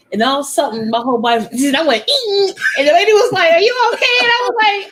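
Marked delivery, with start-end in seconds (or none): 2.1-2.6 high little yelp; mock startled eek; imitated startled squeak. Imitating own jolt from vibrator. 3.5-4.2 high-pitched mock concern; high, exaggerated polite alarm; concerned stranger.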